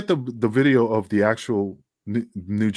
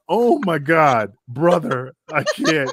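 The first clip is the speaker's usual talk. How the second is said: slow laughing plea; 5 semitones higher, 5 dB louder, slower than usual. Retold or imitated. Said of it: "And he's like".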